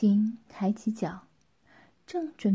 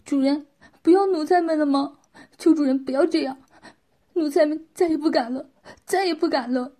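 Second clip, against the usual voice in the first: higher, louder anxious begging; pleading while kowtowing; 5 semitones higher, 6 dB louder, faster than usual. A narrator's reading. Servants begging their master not to abandon them, anxious pleading. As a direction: plead like a servant afraid of being cast off. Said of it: "边说着边磕着头".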